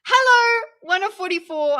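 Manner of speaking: high, loud shouted hello; real loud hello to a chatter; yelling a greeting. Real, not acted. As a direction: shout hello to one viewer. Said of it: "I lost viewers just from yelling".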